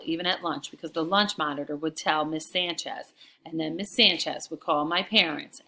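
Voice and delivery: usual speaking voice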